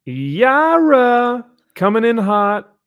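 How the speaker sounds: high, loud, slow hype call; loud high slow hype intro; hyped announcer. Acted. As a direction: announce it like a ring entrance.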